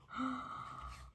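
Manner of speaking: soft breathy gasp; quiet, amazed intake of breath. Real, not acted. Collector seeing the next item, amazed gasp.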